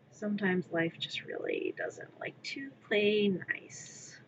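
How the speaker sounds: whispered aside. Pretend. Mock-motivational speech to herself.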